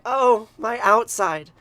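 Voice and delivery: slow, loud drawn-out moan of pain; moaning sigh. Acted. Goddess in labor, a slow loud moaning cry. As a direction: let the goddess groan it out slowly.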